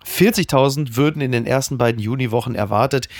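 usual speaking voice

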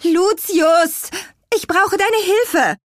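high, loud, stretched-out call; calling for help. A narrator's reading. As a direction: holler up for a friend's help, drawing it out. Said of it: "rief sie".

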